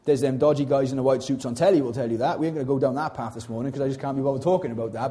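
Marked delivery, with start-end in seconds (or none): none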